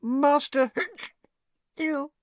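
very high, slow squeak with a hiccup; drunken little elf squeak; tipsy, upset elf. A narrator's reading. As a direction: take in bad news, hiccuping tearfully. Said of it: "Her bottom lip began to tremble".

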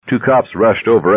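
ordinary delivery

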